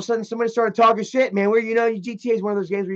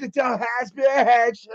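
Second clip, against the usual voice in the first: drawn-out, higher put-on insult; mock heckling; 5 semitones higher, slower than usual. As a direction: imitate a worn-out trash-talker, slowly. Imitates gamers talking themselves hoarse.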